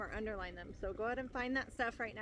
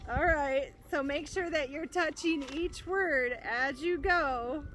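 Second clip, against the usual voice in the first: ordinary talk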